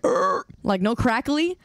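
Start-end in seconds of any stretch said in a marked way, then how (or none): 0.0-0.4 short crackling mic noise; imitated crackle. Shown as a demonstration. Streamer checking the mic with chat, imitated crackle. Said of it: "Like, no crackly? Like".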